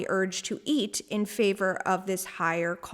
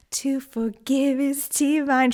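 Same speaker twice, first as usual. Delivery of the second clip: slow, high, louder mock breathy crooning; absurd breathy voice; 5 semitones higher, 5 dB louder, slower than usual. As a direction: ham up a breathy singer. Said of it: "in this absurd breathy voice".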